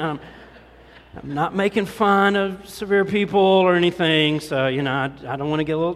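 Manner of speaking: ordinary talk, nothing marked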